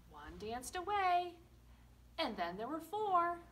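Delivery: put-on sing-song counting rhyme for children; finger rhyme. Teacher leading children in a finger rhyme, sing-song. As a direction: chant it playfully with the kids.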